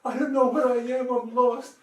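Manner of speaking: high, loud, hammed-up wail; put-on panicky sobbing voice; mock crying. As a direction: imitate a helpless woman wailing down the phone. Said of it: "she's crying on the phone".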